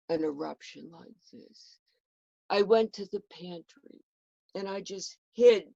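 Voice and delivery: plain reading voice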